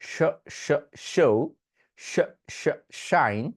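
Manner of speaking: স and শাইন with a thick hushing sh; thick sh drill. Shown as a demonstration. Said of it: "কিরকম ভাবে practice করবেন". (মোটা শ ধ্বনির অনুশীলন)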